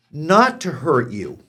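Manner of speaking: ordinary talk, nothing marked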